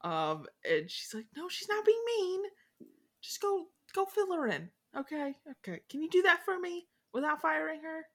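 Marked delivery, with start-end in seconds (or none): 1.3-8.1 much higher voice, pleading but bright; mock Jane. Jane begging Rochester not to fire Fairfax, high-voiced. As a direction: coax him gently from a high pitch.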